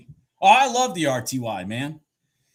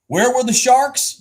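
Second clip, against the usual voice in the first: dramatic question; 11 semitones higher, 8 dB louder than usual. Real, not acted. Trader's dramatic rhetorical question.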